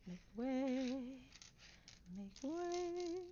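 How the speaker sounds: high playful make way call; coming through shout. Pretend. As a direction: clear the path, sing-song. Moving pieces aside playfully.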